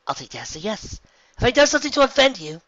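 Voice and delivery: high, loud, cocky hero voice; brash heroine. A narrator's reading. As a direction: bold young flyer calling out. Rainbow Dash talks back.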